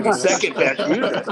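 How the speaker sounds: high, laughing disbelief at the words; incredulous. Retold or imitated. [name] thinking back at the buyer's claim, high amused disbelief. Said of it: "And I'm thinking".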